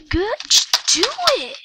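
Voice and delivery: high, loud yell. Real, not acted. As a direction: shout it out in frustration. Yelling at other player.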